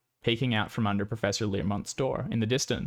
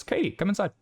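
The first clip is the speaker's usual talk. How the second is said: higher, coaxing professor; professor insisting; 9 semitones higher than usual. Acted. Professor coaxing the student inside, higher. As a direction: call her in gently but firmly. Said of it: "He goes".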